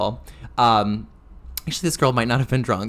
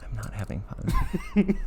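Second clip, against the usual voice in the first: slow, softer deadpan joke; deadpan mock confession; 6 semitones higher, 7 dB quieter, slower than usual. Drag queen joking she is not having fun, slow deadpan.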